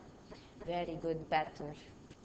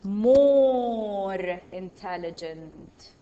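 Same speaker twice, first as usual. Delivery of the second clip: "more intelligent" drawn out slowly and loud; stretched stress on more; 3 semitones lower, 8 dB louder, much slower than usual. Shown as a demonstration. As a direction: lean hard and slow on "more intelligent". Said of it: "So it's going to be what?".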